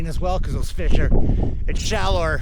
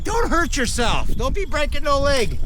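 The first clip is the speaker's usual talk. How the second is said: ordinary delivery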